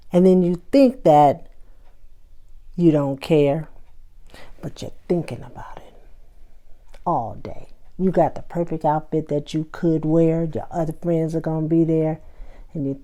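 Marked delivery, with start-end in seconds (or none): 4.6-7.7 hushed knowing whisper, quick; teasing whisper. Real, not acted. To listeners caring about the party, a teasing whisper. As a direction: let them in on a secret.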